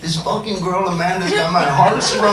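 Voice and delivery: lightly put-on street accent; changed accent. Asking the homeboys for love advice in a light street accent. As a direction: slip into the homeboys' accent a bit. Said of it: "my accent would change".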